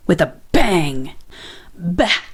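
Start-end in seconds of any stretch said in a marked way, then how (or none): 1.8-2.2 a soft grumbling huff; huffy bah. A narrator's reading. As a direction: huff it under your breath. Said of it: "huffed Grumpy Badger".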